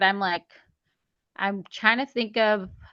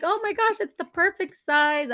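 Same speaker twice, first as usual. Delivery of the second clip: much higher, giddy disbelief; proud giddy author; thrilled; 9 semitones higher than usual. Retold or imitated. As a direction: squeal it with pride. Retold joy at printed book.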